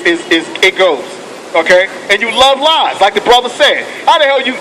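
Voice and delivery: usual speaking voice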